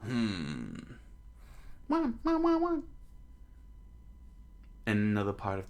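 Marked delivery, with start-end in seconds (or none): 1.9-2.8 very high mock wah-wah wailing; high fake crying; mock baby crying. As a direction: wail it like a bawling baby.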